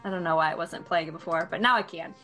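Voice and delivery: usual speaking voice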